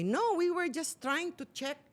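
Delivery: high, slow mock innocent excuse; mocking husband's excuse. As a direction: parody the husband's lame alibi. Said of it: "hindi na siya nag-deny".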